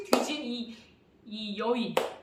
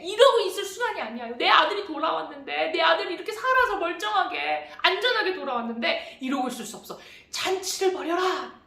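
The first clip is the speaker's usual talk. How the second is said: excited shout; 3 semitones higher than usual. Acted. Father calling to the servants, shouting with joy. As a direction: call out to the servants in delight. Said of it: "라고 막 외쳤어요".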